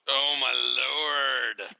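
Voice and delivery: long drawn-out, very slow exasperation; exasperated. Real, not acted. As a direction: stretch every word in disbelief. Groans at pun show title.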